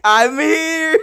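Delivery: high-pitched mock announcement; hammed-up arrival, about to laugh; imitating the ghost. Streamer mimicking the ghost that appeared, high mock greeting.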